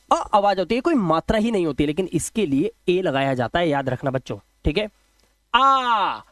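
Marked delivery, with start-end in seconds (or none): 5.5-6.2 drawn-out आ for students; long vowel. Shown as a demonstration. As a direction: stretch आ into a long open ah. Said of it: "लंबी आवाज आ".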